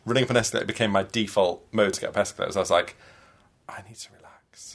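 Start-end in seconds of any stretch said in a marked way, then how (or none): 3.7-4.8 whispered aside to oneself; whispered realisation. Retold or imitated. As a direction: breathe it out in a hushed whisper. Recalled realisation to herself while rushing.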